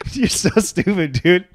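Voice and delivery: slow, high, through laughter; laughing. Real, not acted. Friend cracking up at the impression, laughing affectionate jab. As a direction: laugh while teasing a friend.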